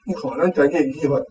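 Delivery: ordinary talk, nothing marked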